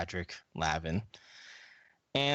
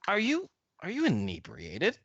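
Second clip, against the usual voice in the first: scandalised suspicion; 7 semitones higher, 3 dB louder than usual. Acted. Hugo sniffs, suspects Frank is drunk.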